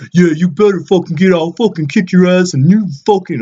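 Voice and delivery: mock the angry guy on the train, loud and shrill-high; imitating aggressive guy. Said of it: "And he goes".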